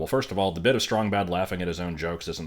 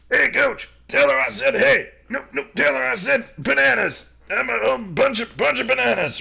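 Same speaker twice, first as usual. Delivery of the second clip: much higher, louder squeaky-voiced Bubs bit; imitating Bubs; 8 dB louder than usual. Commentator replaying Bubs rambling in the background, high mock voice. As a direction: toss off a goofy, high Bubs impression. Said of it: "I love that bit with Bubs there".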